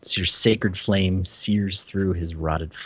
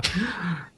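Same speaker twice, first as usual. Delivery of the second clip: mock gasp; 11 semitones higher, 4 dB quieter than usual. Mock shock at claw.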